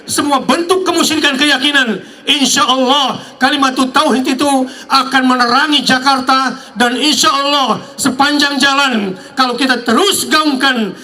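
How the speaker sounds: preaching. Acted. Rising sermon to crowd.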